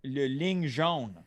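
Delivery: slow, careful "Le ligne jaune"; French translation. Shown as a demonstration. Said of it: "What's it in French?".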